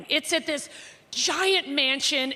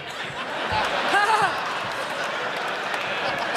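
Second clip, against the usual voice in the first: breathy laugh; 5 semitones higher than usual. Real, not acted. After alkie imitation.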